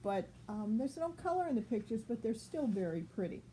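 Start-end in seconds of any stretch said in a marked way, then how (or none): none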